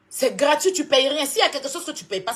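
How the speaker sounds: loud scornful lecture at a rival. Real, not acted. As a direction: hammer home that it's free.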